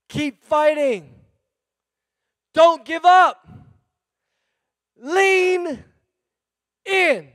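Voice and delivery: slow commands building to a loud preaching shout; God's charge to stay and lean in; rising exhortation. Acted.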